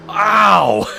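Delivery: loud, high "ow"; pained yell. Acted. Storyteller imagining oil in his eyes, pained yell. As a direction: cry out as if it stings.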